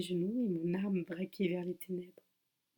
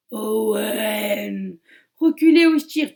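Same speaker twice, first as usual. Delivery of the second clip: shouted warning; 2 semitones higher, 16 dB louder, slower than usual. Acted. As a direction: bark the threat as a last warning. Heroine threatening the killer.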